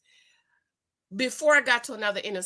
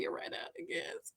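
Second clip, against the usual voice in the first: whispered, higher, much softer; 12 semitones higher, 17 dB quieter than usual. Retold or imitated. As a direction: whisper the realisation to yourself. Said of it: "started saying".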